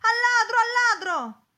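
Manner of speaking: high, very loud cry of alarm; shouting after a thief. A narrator's reading. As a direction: raise the alarm at full voice. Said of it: "gridò il signore".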